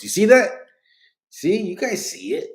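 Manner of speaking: loud, high and excited. Real, not acted. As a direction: call it out excitedly. Pointing out chat to viewers.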